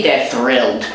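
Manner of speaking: ordinary talk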